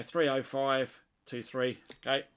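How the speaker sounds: ordinary delivery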